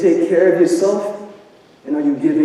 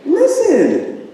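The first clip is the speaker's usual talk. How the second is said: high emphatic reply; slower than usual. Retold or imitated.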